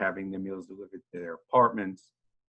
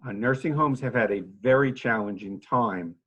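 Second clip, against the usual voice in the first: ordinary talk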